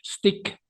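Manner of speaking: "Stick" straight from the hissed s; no leading vowel. Shown as a demonstration. Teacher repeating the corrected word, "Stick" without an e. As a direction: say "Stick" with air through the teeth first. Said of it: "Juntamos nuestras dentaduras y botamos el aire".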